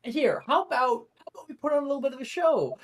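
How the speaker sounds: smooth-talk your way out of a fight, quick and high; talking his opponent down, high and quick; Wilfred's voice. Acted.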